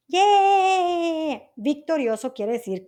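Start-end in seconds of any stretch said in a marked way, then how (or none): 0.1-1.4 high, drawn-out mock cheer; long celebratory whoop, pitched high; playful victory cheer. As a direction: cheer playfully for the hero's win. Cheers Zeus's victory in story.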